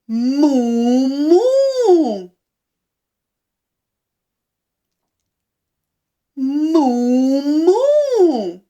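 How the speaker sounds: drawn-out moo rising into another; cow moo. Shown as a demonstration.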